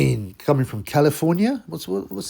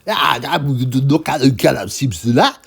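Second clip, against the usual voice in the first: mock African accent; 3 semitones higher, 7 dB louder than usual. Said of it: "he came in".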